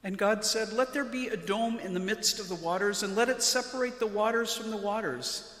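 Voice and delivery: ordinary talk, nothing marked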